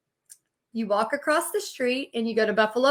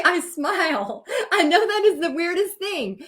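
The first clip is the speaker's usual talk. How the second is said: laughing; 4 semitones higher than usual. Real, not acted. Laughing about bankruptcy.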